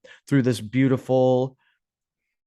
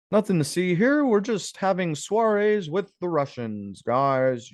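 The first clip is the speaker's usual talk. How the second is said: high sarcastic official voice; sarcastic higher voice mocking innocence; mock propaganda; 6 semitones higher than usual. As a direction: sell the cover story with a smirk.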